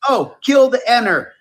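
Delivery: high, loud comic skit voice; hammed-up Chris Rock voice; Chris Rock impression. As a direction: belt it like the stand-up bit. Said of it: "he would go".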